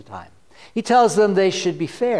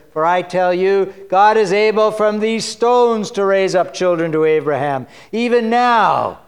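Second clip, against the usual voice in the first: loud prophetic proclamation; booming rebuke read to the congregation; fiery scripture reading; 5 dB louder than usual. Acted. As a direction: preach it like John in the wilderness. Reads John's fiery sermon.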